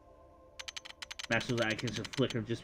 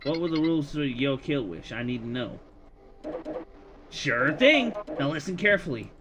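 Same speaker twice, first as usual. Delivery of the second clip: higher, brash wish demon; 5 semitones higher, 4 dB louder than usual. Acted. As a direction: play a smug scheming demon. Demon NPC speaks.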